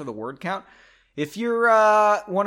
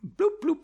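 silly bloops; 3 dB louder than usual. Pretend. Silly sound.

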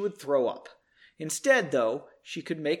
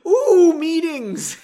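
loud, high, drawn-out ooh; hammed-up thrill, breaking into a laugh; mock excitement; 13 semitones higher, 12 dB louder, much slower than usual. Narrator joking about the meeting in the story, mock excited.

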